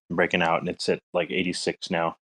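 ordinary talk, nothing marked

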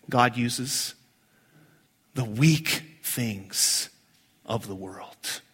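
hushed sermon emphasis; solemn. Real, not acted. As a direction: let it sink in, quietly. Slow solemn fading phrase.

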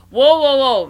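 high, loud startled whoa; alarmed. Retold or imitated. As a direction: stop a customer in alarm. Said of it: "and I was like".

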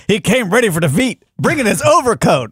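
high mock-serious reporter voice. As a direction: parody the anchor's line. Mocking the news report.

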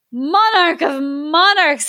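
slow, high, wailing cry; Vizier crying out. Acted. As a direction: slowly wail it like a courtier. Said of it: "he cried".

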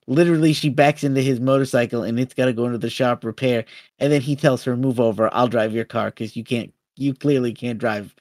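ordinary talk, nothing marked

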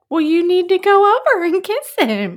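high, loud mocking voice; mock meddling people. Host mimics horrible people goading a contestant, in a high mocking voice. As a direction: imitate pushy people egging her on. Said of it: "some horrible people said".

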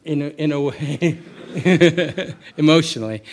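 laughing through the answer, amused by the question; chuckling as he admits it. Real, not acted. Amused by a listener's bomb-maker joke, laughing.

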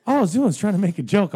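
high, loud flustered whine; flustered protest. Retold or imitated. Salesman defending his joke to customer, high flustered whine. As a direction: plead he only meant a joke.

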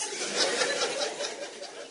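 long quiet breathy chuckle; soft laugh. Real, not acted. Father recalling his daughter's reply, quiet breathy laughter. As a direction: laugh under your breath, amused.

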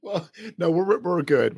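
ordinary talk, nothing marked

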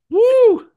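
very high, loud "woo!"; mock-thrilled whoop. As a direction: whoop loudly in mock excitement. Mock thrill after dull sales greeting.

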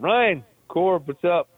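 high, upbeat phone hello, acted out; retold hearty greeting. Patient phoning his sergeant friend, retold hearty greeting.

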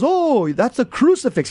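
usual speaking voice

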